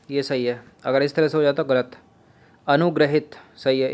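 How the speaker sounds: ordinary delivery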